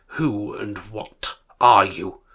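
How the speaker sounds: put-on slow, loud, old miser's challenge; demanding Scrooge. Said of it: "Scrooge demanded".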